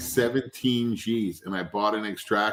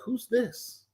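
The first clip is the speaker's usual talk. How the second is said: retold shop worker; 12 semitones higher, 5 dB quieter than usual. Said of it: "And he's like".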